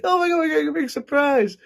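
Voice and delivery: high, squealing hammed-up excitement; thrilled kids. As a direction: squeal like two kids hugging over a prize. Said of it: "all excited, we're hugging each other".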